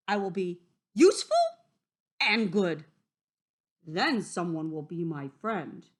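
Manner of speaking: loud, quick wolf resolve; determined wolf. Acted. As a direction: declare it eagerly.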